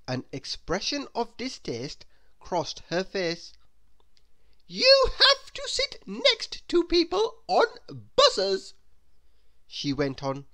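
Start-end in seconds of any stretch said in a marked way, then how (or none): 4.7-8.7 very high, loud, almost wailing indignation; a much higher, louder melodramatic whine; snobbish Miss Splendid. Acted. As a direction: overplay the horror of buses. Miss Splendid scorns the bus.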